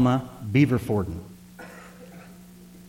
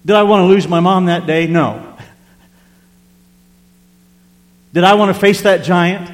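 preaching rhetorical question; 6 semitones higher, 8 dB louder than usual. Real, not acted. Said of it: "preaching at full force".

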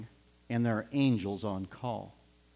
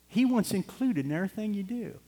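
higher and higher, urging; rising fervor; 9 semitones higher than usual. Real, not acted. Preacher urging listeners to take God along, rising. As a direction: build up the plea.

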